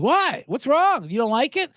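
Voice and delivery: high-pitched, puzzled customer; acted eager customer; concerned questioning. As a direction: press him with high mock-innocent questions. Acting eager customer questioning owner.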